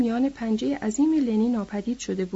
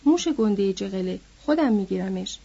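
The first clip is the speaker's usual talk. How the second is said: usual speaking voice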